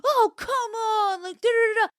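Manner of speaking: mimicking the dog owners brushing her off; mocking dog owners. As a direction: whine it like the careless dog owners. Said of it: "They're like".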